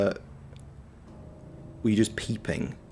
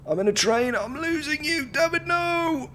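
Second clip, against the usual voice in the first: much higher, stretched voice as if cutting out; mock bad phone line. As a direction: pretend the call is breaking up on a train. Mock losing signal on a call.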